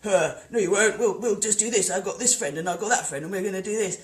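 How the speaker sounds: loud, brash boasting voice; mock rival leader. Imitating a leader bragging about his friends, loud and brash. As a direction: puff up like a smug rival leader. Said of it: "another guy who's got a huge continent behind him going".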